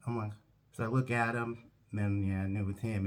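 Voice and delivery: usual speaking voice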